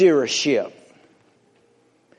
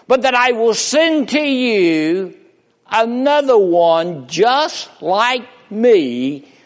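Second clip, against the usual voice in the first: rising preacher's build, louder and higher; preaching; 7 semitones higher, 3 dB louder than usual. Acted. Preacher lifting his voice to the choir, building. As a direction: preach it up to the promise.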